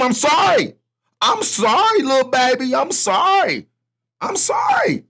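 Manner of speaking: high, sing-song fake sorry; mock baby-talk apology to Ukraine. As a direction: coo a sarcastic sorry to a baby.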